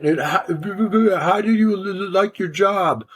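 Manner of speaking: a light Indian accent impression. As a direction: imitate the boss with an Indian accent, stretching the words out. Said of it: "with that Indian accent".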